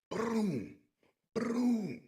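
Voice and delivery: a sharp high "boom" mimicking each slam; imitated slam noise. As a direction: throw out the impact sound like a comic-book hit. Imitates slamming him against wall.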